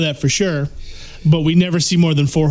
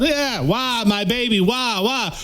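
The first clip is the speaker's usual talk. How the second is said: higher, half-hearted fake sob; mock crying kid; 5 semitones higher, slower than usual. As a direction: fake a weak little cry. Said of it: "this woman cried".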